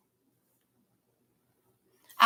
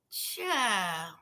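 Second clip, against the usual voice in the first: ordinary talk, nothing marked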